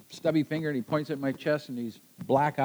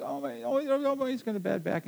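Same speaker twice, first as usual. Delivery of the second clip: groaning patient; 10 semitones higher, 5 dB quieter than usual. Retold or imitated. Guard groaning about back.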